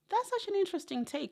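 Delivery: very high-pitched acted reaction; high-voiced imitation of reacting to an odd opinion; retold polite surprise. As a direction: lift it right up, as if politely taken aback.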